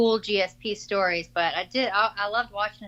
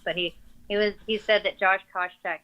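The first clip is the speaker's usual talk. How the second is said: usual speaking voice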